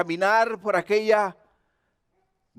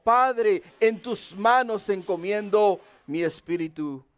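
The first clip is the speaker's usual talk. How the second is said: ordinary talk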